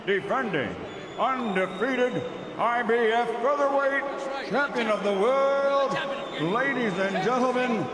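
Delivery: high, booming bilingual intro; lucha libre announcer. Acted. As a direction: stretch the champion's name out. Ring announcer introduces champion.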